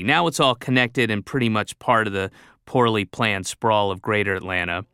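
ordinary delivery

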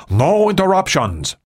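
high-pitched, falling order; irritated boss. A narrator's reading. Ri warns his receptionist off, irritated. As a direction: lay down the order, pitched well up.

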